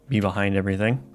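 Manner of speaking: ordinary delivery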